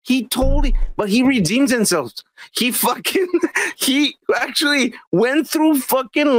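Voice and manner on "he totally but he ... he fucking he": high, heated rant; excited. Real, not acted. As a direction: push it up with passion. Excited argument about Buzz Lightyear.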